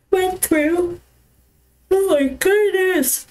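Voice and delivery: words stretched by a yawn. Real, not acted. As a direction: yawn while you talk. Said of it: "I just started yawning so bad".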